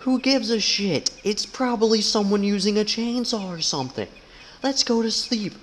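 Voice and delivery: lifted high, bored and dismissive; high character voice. Pretend. Gamer voicing a sleepy friend, high and dismissive.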